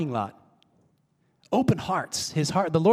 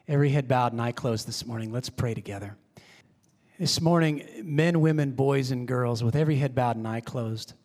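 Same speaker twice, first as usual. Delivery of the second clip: low, soft prayer voice, fading quieter still; quiet altar call; 5 semitones lower, 4 dB quieter than usual. Acted. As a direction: draw the room in gently with every head bowed. Leads closing prayer, altar call.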